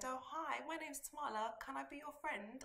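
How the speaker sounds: put-on a child's high, eager try at a British accent; a seven-year-old faking British to win new friends, British accent. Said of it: "I tried to put on this British accent".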